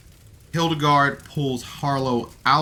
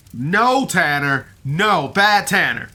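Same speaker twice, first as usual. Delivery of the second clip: higher, louder playful scold of a naughty dog; mock telling-off, louder and higher; 5 semitones higher, 5 dB louder, slower than usual. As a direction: scold him like a puppy that misbehaved, slow on bad Tanner. Scolding the GM like a pet.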